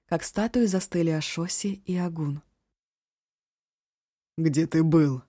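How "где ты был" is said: рокочущий голос. Acted. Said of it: "пророкотал старший брат".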